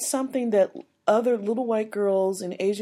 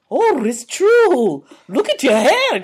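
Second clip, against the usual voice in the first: imitated date; 4 semitones higher, 5 dB louder, slower than usual. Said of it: "He said".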